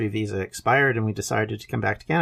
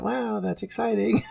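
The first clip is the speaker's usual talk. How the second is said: higher, mock-excited sarcasm; sarcastic reply; 11 semitones higher than usual. Young boy answering his teasing grandfather, sarcastic mock excitement. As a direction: fake enthusiasm, clearly unimpressed. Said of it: "And I went".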